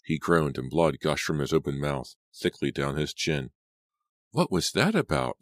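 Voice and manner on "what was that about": higher, louder demanding question. A narrator's reading. A bystander demanding what the fight was about. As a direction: call out for an explanation. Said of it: "somebody behind me demanded".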